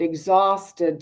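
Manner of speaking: usual speaking voice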